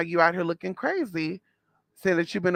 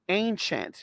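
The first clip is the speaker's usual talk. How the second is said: ordinary talk, nothing marked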